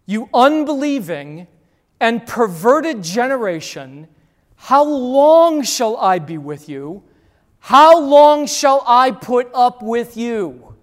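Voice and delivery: loud, slow exasperated rebuke; forceful rebuke. Acted. Jesus rebuking the crowd, loud and exasperated. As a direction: thunder it at a faithless crowd. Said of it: "pretty forceful words here".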